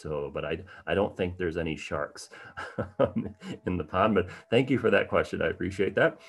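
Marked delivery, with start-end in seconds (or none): 2.4-3.6 brief amused chuckle. Real, not acted. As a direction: let out a small laugh. Amused by sharks in pond.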